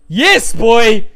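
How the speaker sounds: loud celebratory cheer; excited. Real, not acted. Fan celebrating Leclerc's fun battle, loud cheer. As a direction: cheer it like a goal.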